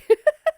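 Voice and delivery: sudden high squeal of laughter; real loud laugh. Real, not acted. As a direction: burst out laughing. Laughs at full on.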